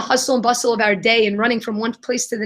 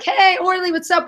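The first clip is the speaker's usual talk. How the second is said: higher-pitched imitation of an eager hello; chummy greeting; 7 semitones higher than usual. Street cleaners greet their new friend Orly, chummy hello. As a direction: greet like instant best friends.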